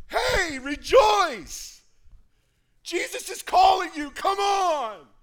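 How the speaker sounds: high, loud, slow joyful shout; excited cheer. Acted. Crowd excitedly cheering the beggar toward Jesus. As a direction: cheer him on, thrilled.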